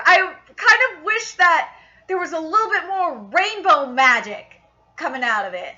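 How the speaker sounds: ordinary delivery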